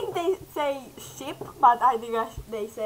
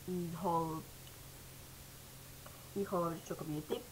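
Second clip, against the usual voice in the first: soft, lower, contrite; a softer, remorseful apology; subdued apology; 6 semitones lower, 9 dB quieter than usual. Real, not acted. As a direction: quietly say sorry to viewers. Subdued apology after outburst.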